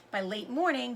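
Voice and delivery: plain reading voice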